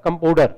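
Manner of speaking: "कंपाउंडर" reduced to "kampodar"; mispronounced compounder. Shown as a demonstration. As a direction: say "कंपाउंडर" as kam-po-dar, O for "au". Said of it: "किस रूप में परिवर्तित होता है". ("कंपाउंडर" को "कंपोडर" जैसा बोलकर)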